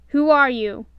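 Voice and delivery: loud, pushy question; gruff demand. A narrator's reading. Grumpy demanding the stranger's name, loudly. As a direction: bark it at the stranger. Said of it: "Grumpy said".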